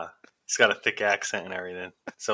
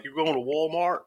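higher southern-style "You're going to Walmart?" with the r in Walmart sounded; friend's own southern say-it, a bit higher; his usual way; 7 semitones higher than usual. Retold or imitated. Retelling how the southern friend normally says it, higher. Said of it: "he usually says it like".

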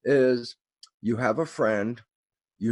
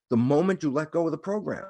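ordinary talk

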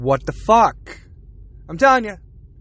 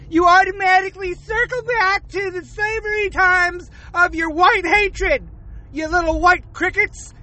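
higher, hammed-up lecturing rant; mock outrage; 6 semitones higher than usual. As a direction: play a preachy scold wagging a finger.

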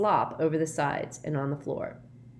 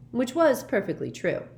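usual speaking voice

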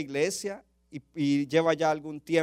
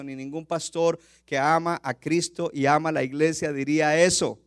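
normal talking voice

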